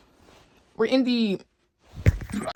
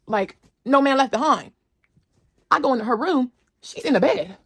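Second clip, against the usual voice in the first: ordinary talk, nothing marked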